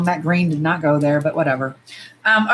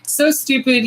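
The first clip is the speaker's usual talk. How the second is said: ordinary talk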